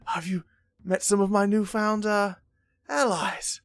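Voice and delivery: high, loud, booming welcome; jovial lord. Acted. Baltazar welcoming the Randall, loud and jovial. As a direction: play the grand host with open arms.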